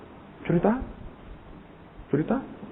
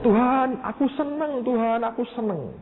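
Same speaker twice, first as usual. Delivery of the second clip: higher, delighted call to God; joyful cry; 12 semitones higher than usual. Retold or imitated. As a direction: repeat it bursting with joy. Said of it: "Terus dia ngomong". (berseru gembira dengan nada tinggi)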